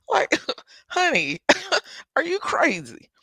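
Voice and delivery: high-pitched, giggly incredulous question; laughing disbelief. Retold or imitated. Storyteller reacting to the family's scheme, laughing in disbelief. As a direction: laugh through the words in mock outrage.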